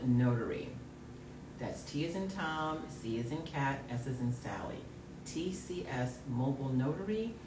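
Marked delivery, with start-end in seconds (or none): 1.6-7.3 low, soft sultry ad read; sexy voice. Acted. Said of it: "Stop with the sexy voice".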